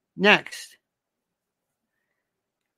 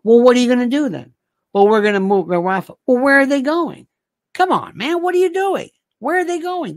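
louder, higher exasperated demands; exasperated rant; 5 semitones higher, 4 dB louder than usual. Real, not acted. Comic ranting at Israel's leaders, fed up and loud. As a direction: push it, fed up and heated. Heated rant about Rafah.

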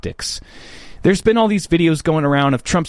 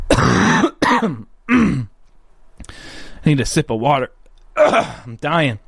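real failing voice between coughs; losing his voice; slower than usual. Real, not acted. Vlogger fighting a bad voice mid-show, rough and strained. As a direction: push words through a worn-out, failing voice. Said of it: "can hear it in my voice".

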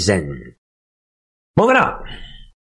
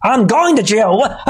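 higher, mock-bewildered everyman; mock panicked citizen; 8 semitones higher than usual. Mocking a lawnmower owner sent to jail, high and bewildered. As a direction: play the baffled guy hauled off to jail.

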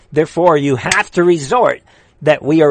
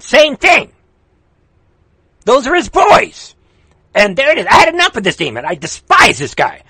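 high-pitched, heated political rant; real exasperation, urgent and punchy; worked up; 6 semitones higher than usual. Real, not acted. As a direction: rail at them with raised pitch. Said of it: "I don't wanna get worked up".

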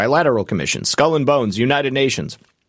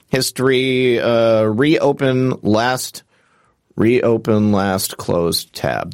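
ordinary delivery

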